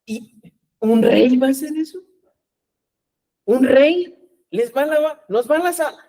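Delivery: high, incredulous questioning; mock disbelief. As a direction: act out baffled disciples doubting a king. Acting out the baffled disciples.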